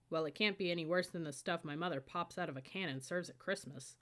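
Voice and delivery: usual speaking voice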